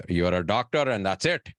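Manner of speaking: a hint of a strict immigrant father; mimicking his dad. Son imitating his physician father's career rule, lightly voiced. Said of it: "that's, like, my dad".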